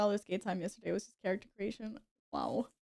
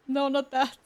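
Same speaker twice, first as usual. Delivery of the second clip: louder, higher quick refusal; 4 semitones higher, 9 dB louder than usual. Real, not acted. Streamer correcting a setting, louder. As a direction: correct yourself firmly. Rejecting wrong option.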